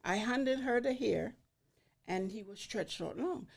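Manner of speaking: ordinary delivery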